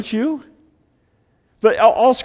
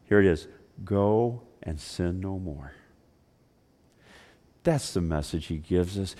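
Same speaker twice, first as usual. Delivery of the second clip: quiet, low storytelling; tender retelling of Jesus; gentle, quiet preaching; soft sermon narration; 6 semitones lower, 7 dB quieter than usual. Acted.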